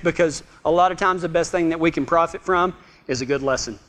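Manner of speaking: normal talking voice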